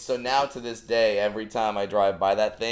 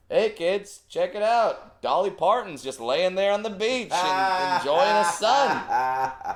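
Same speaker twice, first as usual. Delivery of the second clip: much higher, bright announcing; goofy dad voice; 10 semitones higher than usual. Retold or imitated. Dad to kids in the car, high and goofy. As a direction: hype it up like a dad pointing out the window. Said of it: "you would look over and be like".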